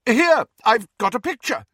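high, loud offer; shouting. A narrator's reading. Lupin loudly offering Fleur a photo. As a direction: push past the awkward silence. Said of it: "Lupin shouted".

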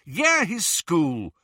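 high, slow, falling retort; stubborn, emphatic confirmation; defiant insistence. Acted. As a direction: affirm it firmly, word by word. Harry insists back at Ron.

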